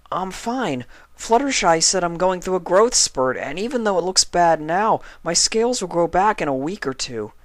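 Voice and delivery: high, eager little dragon voice; young dragon Spike. Acted. Spike reassures sick Twilight, high young dragon voice.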